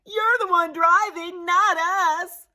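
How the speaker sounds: high, cheeky shouted comeback; kids yelling back. A narrator's reading. Kids answer grumpy Shifty, yelling back. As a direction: fire it back like sassy kids. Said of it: "the kids yelled back".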